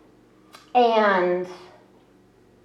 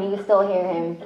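normal talking voice

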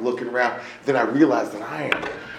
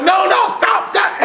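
panicked shout; 7 dB louder, slower than usual. Retold or imitated. Begging lady to stop.

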